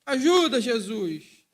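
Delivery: loud plea for help; cry of the soul. Retold or imitated. Brother Viana crying loudly for help. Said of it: "aquele grito da alma".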